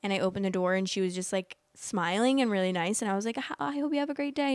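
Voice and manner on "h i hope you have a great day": polite greeting. Retold or imitated. Retold greeting to delivery driver.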